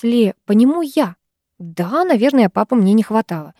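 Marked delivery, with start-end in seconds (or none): none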